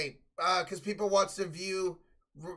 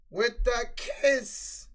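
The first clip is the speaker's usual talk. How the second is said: voice cracking; 6 semitones higher, 4 dB louder, much slower than usual. Acted. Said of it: "I heard her voice crack".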